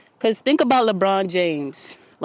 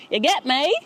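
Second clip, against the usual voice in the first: a much higher rising "You get me?" yelled playfully; mock loud hype; 8 semitones higher than usual. Shown as a demonstration. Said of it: "I'm mad loud".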